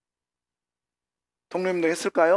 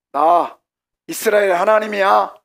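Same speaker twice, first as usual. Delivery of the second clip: loud, raised-pitch declaration; God's commanding voice; 4 semitones higher, 8 dB louder than usual. Acted. Preacher as God waking Cyrus, loud commanding announcement. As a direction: announce yourself with booming authority.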